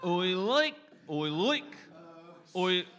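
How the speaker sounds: testing broad Australian "oi" repeatedly; Australian accent warm-up. Shown as a demonstration. Comic warming up for an Australia show, practising an Australian accent. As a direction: work up to an Australian "oi, like". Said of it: "phrase that I used to warm up".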